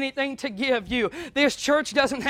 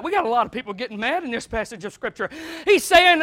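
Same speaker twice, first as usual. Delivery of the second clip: ordinary delivery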